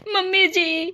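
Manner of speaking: ordinary talk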